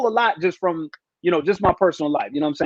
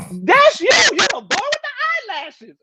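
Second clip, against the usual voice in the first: higher, lightly voiced fan insisting; mock excited woman; 14 semitones higher than usual. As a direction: imitate the sister pointing him out, higher. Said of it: "the sister just yelled out".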